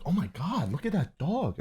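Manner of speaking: mildly acted awe, pitch jumping up; mom's excited exclamation. Mom's excited cry retold.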